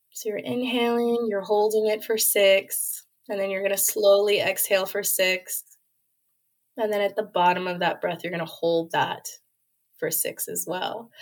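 guided breathing. Acted. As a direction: calmly count them through the breath. Leads a breathing exercise.

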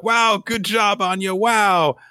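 high, loud admiring cheer; impressed cheering. Retold or imitated. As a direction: gush over her success. Classmates congratulate Anya.

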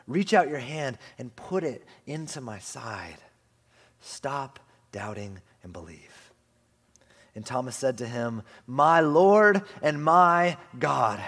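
hushed reverent reading of Jesus' words; slow hushed scripture reading. Acted. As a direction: read it as a tender invitation.

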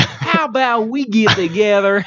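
high, slow, hammed-up English accent; mock English accent. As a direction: overdo a silly English accent. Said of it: "that's my English accent".

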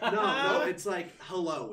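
loud laughing protest, words breaking through laughter. Real, not acted. Laughing as they explain slang to a German friend, loud and amused. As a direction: laugh your way through the correction.

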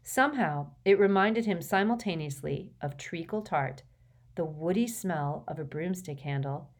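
ordinary delivery

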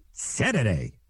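"Saturday" drawn into "Sederday"; mock the slip, saying "Saturday" as "Sederday"; imitated mispronunciation. Mimicking radio voice who couldn't say it, mispronounced "Saturday". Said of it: "he couldn't say Saturday".

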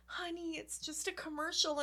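soft, hushed gentle reassurance; a touch of a kind operator soothing a child; gentle hotline lady. Retold or imitated. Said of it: "she was like".

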